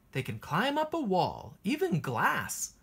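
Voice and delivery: ordinary delivery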